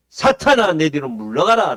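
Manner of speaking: forceful shouted command; booming acted rebuke from the pulpit; loud rebuke. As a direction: order the devil away at full volume.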